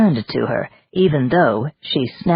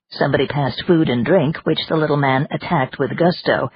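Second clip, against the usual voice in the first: plain reading voice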